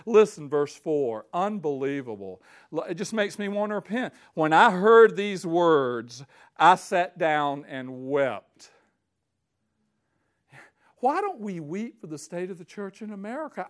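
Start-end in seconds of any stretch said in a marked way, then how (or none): 4.3-8.7 slow, louder, weighty reading; solemn scripture reading. Acted. Preacher reading Nehemiah's lament, slow and solemn. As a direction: read each word like it weighs a ton.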